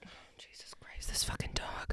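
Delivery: whispered curse at the dog; exasperated whisper. Real, not acted. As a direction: hiss it under your breath, fed up.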